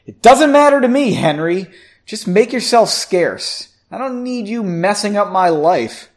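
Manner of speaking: high, loud angry outburst; angry interruption. A narrator's reading. Margot telling Henry to stay away, angry. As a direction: cut in sharply and dismiss him. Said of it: "Again, she interrupted".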